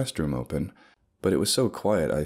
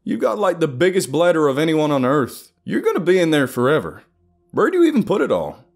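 high, loud, exasperated griping; loud, high-pitched teasing complaint; 11 semitones higher, 9 dB louder than usual. A narrator's reading. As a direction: pitch it way up and gripe at a friend. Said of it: "Dex complained".

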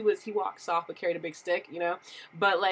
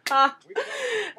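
high laugh; 13 semitones higher than usual. Real, not acted. Laughing at own joke.

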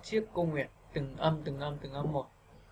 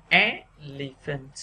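"Elephant" slowly, rising "E", then falling syllables; exaggerated syllable stress; 2 semitones lower, 3 dB louder, much slower than usual. Shown as a demonstration. Teacher leading learners through the word, slow stressed start. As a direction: stretch and lift the first syllable of "Elephant". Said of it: "bây giờ các bạn sẽ đọc nhé".

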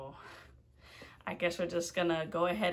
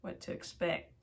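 usual speaking voice

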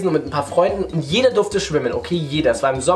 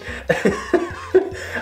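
stifled giggles; 5 semitones higher than usual. Real, not acted. Stifled laughter continues.

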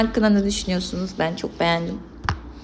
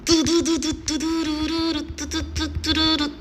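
high, steady string of du sounds; rattling mouth-made du-du-du; rapid-fire du-du noises; 7 semitones higher than usual. Pretend. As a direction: imitate a machine gun with du-du-du. Imitates rapid fire in game.

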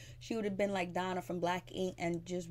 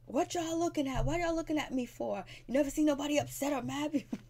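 much higher, fast, mock outraged; mock angry yell; 7 semitones higher than usual. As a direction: throw it at the onlookers, indignant. Little Mo yelling at fans.

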